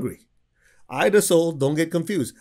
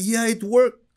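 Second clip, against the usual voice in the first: high, louder eager reply; so excited; 9 semitones higher, 6 dB louder, slower than usual. Retold or imitated. Caller excitedly insisting tithing worked, high and eager. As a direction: chirp it out with glee. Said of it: "She's so excited".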